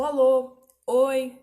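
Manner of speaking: loud calling hellos. Retold or imitated. A teacher calling loud greetings to get someone's attention. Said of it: "Como eu disse".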